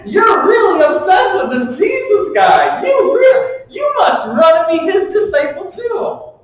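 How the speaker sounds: high, loud, laughing mockery of the Pharisees; mocking sarcasm. Healed man taunting the Pharisees, loud laughing mockery. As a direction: shout the taunt through laughter, teasing. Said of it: "this is the same energy".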